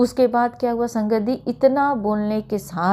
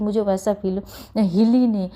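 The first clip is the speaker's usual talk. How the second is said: ordinary talk, nothing marked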